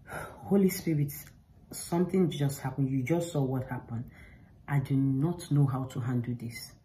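soft, pleading murmur to the Spirit; hushed prayer. Retold or imitated. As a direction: pray it under your breath, imploring. Retold prayer to the Holy Spirit.

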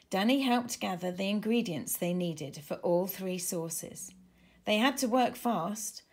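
ordinary delivery, nothing marked

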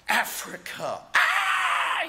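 hammed-up panic at the dreaded call; mock horrified yelp; mock scream of terror. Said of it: "people are scared to death".

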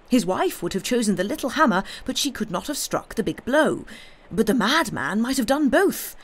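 high, insistent reasoning; carried away by a glimpse of the truth; feverish excitement. A narrator's reading. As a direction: rattle out the theory eagerly. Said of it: "he cried feverishly".

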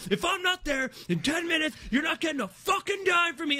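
high-pitched mock ultimatum; angry threat. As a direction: threaten the driver in imitated outrage. Acted threat to the driver.

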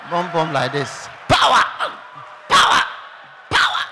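very loud barked cries; power shout. Pretend. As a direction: shout it like a charismatic healer. Preacher mocking altar shout.

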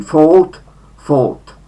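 fought said with a lengthened O; long O in fought. Shown as a demonstration. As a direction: hold the O in fought. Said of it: "wydłużone o". (fought z wydłużonym o)

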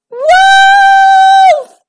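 high, loud shrieked name; frightened scream. A narrator's reading. Ruby sees the wolf towering over her, frightened scream.

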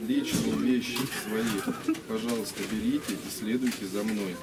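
ordinary talk

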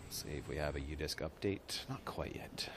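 hushed broadcast aside; whispering. Real, not acted. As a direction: whisper it off mic.

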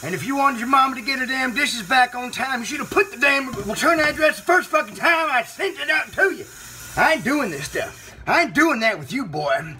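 high, heated telling-off; indignant scolding. Acted. Host scolding caller over return address, heated and high. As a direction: let the indignation push the pitch up.

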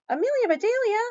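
high, loud exasperated yell. A narrator's reading. Mister Rogers after she frees the fish, yelling. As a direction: yell the name in protest. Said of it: "yelled Mister Rogers".

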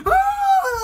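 sharp high scared cry; high frightened yelp. Retold or imitated.

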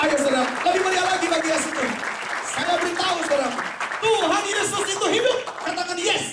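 high, loud, fast rallying sermon; preaching at full force. Acted. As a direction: whip the crowd up. Preacher rallies the congregation.

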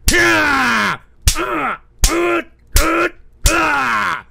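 shouting hoarse and loud at chat; angry yelling. Real, not acted. Streamer bellowing at trolls in chat, furious yell. Ranting streamer yells at viewers.

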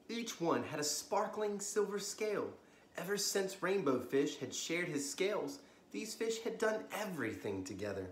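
lively bedtime storybook reading; story narration. A narrator's reading. Storyteller reading to kids at bedtime, lively. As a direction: read it like a children's librarian at story time.

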